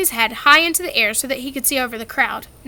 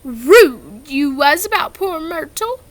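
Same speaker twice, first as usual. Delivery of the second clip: slow, louder, mocking poltergeist; gleeful Peeves; 3 semitones higher, 6 dB louder, slower than usual. A narrator's reading. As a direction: savour the tease, drawn out. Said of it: "his eyes dancing".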